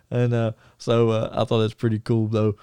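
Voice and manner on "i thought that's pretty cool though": ordinary talk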